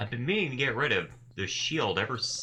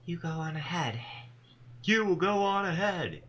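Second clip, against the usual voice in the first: strangely rhythmic voice; 10 semitones higher than usual. Acted. Tall woman chants to the gathered Dustbound, strangely rhythmic. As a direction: intone it like an eerie ritual chant. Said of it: "She begins to speak in a strangely rhythmic voice".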